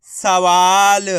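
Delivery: सवाल very slow and loud, long वा; drawn-out model of सवाल; blended word. Shown as a demonstration. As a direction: stretch सवाल out for learners. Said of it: "तो बताइए मिलके क्या बनेगा".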